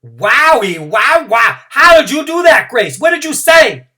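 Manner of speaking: very high, loud astonished gush; be wowed by it; amazed. Acted. Junie B asking Grace how she did it, very high and amazed.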